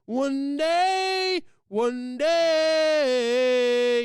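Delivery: high, slow, loud chant-like proclamation; proclaiming crowd. Acted. Vlogger voicing the people chanting for peace, loud and high. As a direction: call it out like a rallying crowd. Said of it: "And the people will say".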